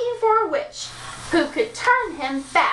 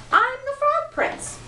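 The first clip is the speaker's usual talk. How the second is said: high insistent prince; 12 semitones higher than usual. Acted.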